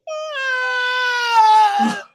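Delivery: high crying wail; mock wail. Imitating his daughter's fake crying, high wail. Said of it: "She's like".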